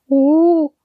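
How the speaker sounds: high, startled little dog gasp; a small dog stunned to see the wolf dog; disbelief. A narrator's reading. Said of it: "said Stretch in disbelief".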